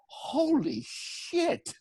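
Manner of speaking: very slow, high, drawn-out amazement; stunned disbelief. Retold or imitated. Guard to his partner on seeing the proof, stunned. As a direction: stretch both words out in mock awe.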